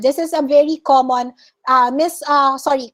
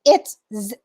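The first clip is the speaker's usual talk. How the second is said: normal talking voice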